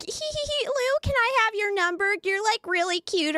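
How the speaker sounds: high giggly mocking voice of a flirty admirer. Imitating people who hit on him, high and giggly. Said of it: "they were like".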